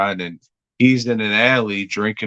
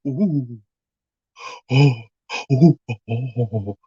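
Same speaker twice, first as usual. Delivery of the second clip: pleasured moans; 2 semitones higher than usual. Retold or imitated. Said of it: "Not like".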